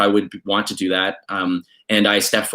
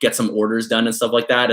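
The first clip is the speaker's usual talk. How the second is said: ordinary talk, nothing marked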